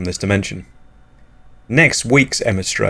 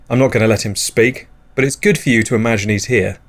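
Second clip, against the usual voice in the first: normal talking voice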